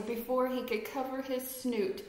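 ordinary delivery, nothing marked

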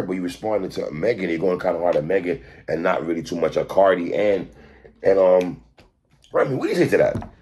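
low, understated question to a guest; tactful interviewer. Shown as a demonstration. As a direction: pose it carefully in a low interviewer's voice. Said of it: "The inflection in your voice".

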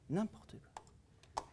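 slow whispered scoff; whispered aside. Real, not acted. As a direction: breathe it out as a scoff.

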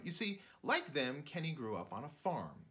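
ordinary delivery, nothing marked

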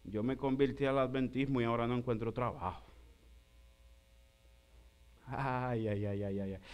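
low, soft moaning ay ay ay; mock lament. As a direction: groan like a jobless believer. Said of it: "Y dice".

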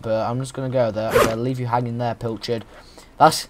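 usual speaking voice

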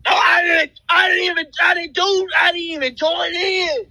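ordinary talk, nothing marked

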